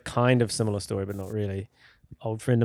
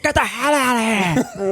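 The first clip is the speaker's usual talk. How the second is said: mock yell; 9 semitones higher than usual. Friend escaping the hand, high mock yell. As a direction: holler it while fleeing.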